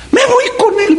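a grieving wife crying she'll follow him, shrill on purpose; high mock wail of a widow. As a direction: wail it out way up high, hammed-up grief. Widow's lament, acted mockingly.

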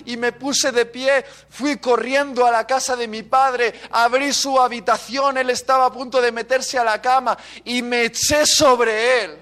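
high, forceful storytelling; fervent, emotional pulpit delivery; impassioned preaching. Real, not acted. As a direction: drive the testimony on, stretching the climaxes.

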